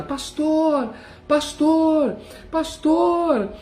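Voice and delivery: high desperate cry for the pastor; desperate shout. Retold or imitated. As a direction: call out for help at the top of your voice. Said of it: "comecei a gritar desesperado".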